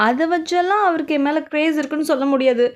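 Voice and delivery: usual speaking voice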